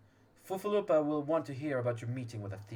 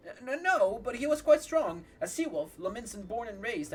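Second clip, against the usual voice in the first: flustered; 8 semitones higher, 4 dB louder than usual. Acted. Voicing flustered NPC reply.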